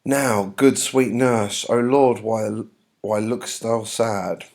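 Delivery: dramatic play reading. Acted. Juliet asking the nurse why she looks sad, theatrical. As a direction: plead slowly with mild theatrical worry.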